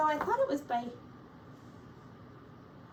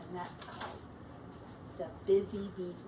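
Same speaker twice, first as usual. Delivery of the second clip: story narration. A narrator's reading. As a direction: read it like a picture book for kids.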